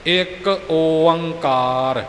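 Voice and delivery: ਇੱਕ ਓੰਕਾਰ sung very slowly, with calm devotion; slow and loving. Shown as a demonstration. Teacher shows learners calm recitation, slow devotional chant. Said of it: "ਬੜੇ ਠਰੰਮੇ ਨਾਲ, ਠਹਿਰਾ ਨਾਲ, ਪ੍ਰੇਮ ਦੇ ਨਾਲ".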